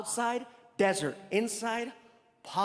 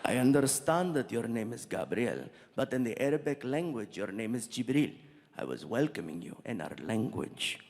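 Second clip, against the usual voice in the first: dignified prince; 9 semitones lower than usual. Retold or imitated. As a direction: explain patiently as a low-voiced royal. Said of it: "And he's like".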